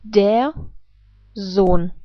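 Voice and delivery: "der Sohn" with a voiced z and a long o; teacher repeats the word; German demo. Shown as a demonstration. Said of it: "I repeat".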